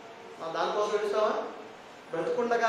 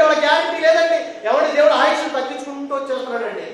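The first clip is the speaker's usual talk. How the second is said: raised, loud exhortation; forceful preaching plea; 7 semitones higher, 9 dB louder than usual. Real, not acted. Preacher warning congregation death comes unannounced, forceful preaching. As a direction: urge them hard to change their lives.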